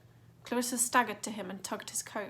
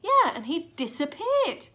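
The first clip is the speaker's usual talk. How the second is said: slow, higher firm insistence; girl insisting firmly; 7 semitones higher, 8 dB louder than usual. A narrator's reading. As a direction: stress it stubbornly. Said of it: "said Clarissa firmly".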